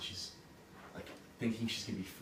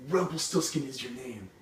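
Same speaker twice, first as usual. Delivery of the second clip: loud, raised-pitch announcement; triumphant reveal; 5 semitones higher, 8 dB louder than usual. Acted. Queen finally names the goblin, triumphant. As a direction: proclaim it boldly, pitched up.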